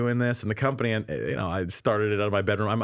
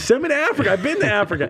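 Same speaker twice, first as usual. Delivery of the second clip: laughing plea; 11 semitones higher, 4 dB louder, faster than usual. Retold or imitated. Eager plea to God, laughing.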